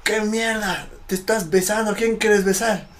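high-pitched scolding drill voice; retold yelling superior. Superior mocking a shivering recruit's lips, high scolding imitation. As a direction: berate the recruit, pitched well up. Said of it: "me grita, pues, en mi oreja".

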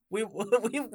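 laughing while being tickled; giggly, tickled protest. Real, not acted. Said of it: "stop tickling me".

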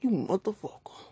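mock angry grumble under the breath; tight-mouthed mutter. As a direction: hiss it at the partner half-awake. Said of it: "Your mouth tight as shit".

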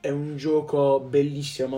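ordinary talk, nothing marked

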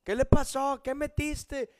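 hammed-up accusing call; mock shouting. Wife yelling down about the microwave, mock shout. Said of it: "desde arriba gritándome".

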